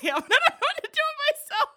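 high, fast, laughing rush; giddy laughing. Real, not acted. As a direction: bubble over with giggles. Giddy before announcing guest.

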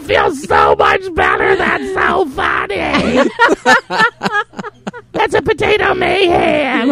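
squeaky mock friend voice, laughing; lightly voiced cartoonish friend; imitating Mew. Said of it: "I don't think she talks like that".

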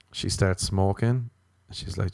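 ordinary talk

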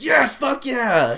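high, slow, stretched-out excited yell; hyped cheer. Retold or imitated. Listener hearing his name on a podcast, high drawn-out cheer.

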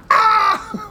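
high, loud fangirl shriek; fan scream. Retold or imitated. Super fan spotting a star, shrieking high. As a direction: let out a squealing fan shriek. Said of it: "super fans who are always like".

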